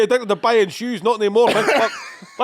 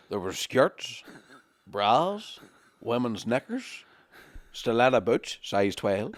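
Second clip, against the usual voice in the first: put-on wrong Irish accent; 8 semitones lower, 6 dB quieter, much slower than usual. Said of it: "I know I'm doing the wrong accent".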